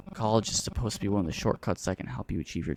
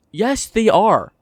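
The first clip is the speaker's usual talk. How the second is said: much higher and louder, slow and pointed; angry retort; 11 semitones higher, 10 dB louder, much slower than usual. Real, not acted. Actor snapping back at the character, slow angry retort. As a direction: slam the line down word by word.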